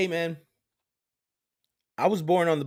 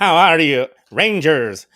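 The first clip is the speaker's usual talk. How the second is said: robot voice; 8 dB louder than usual. Retold or imitated. Power Rangers robot voice.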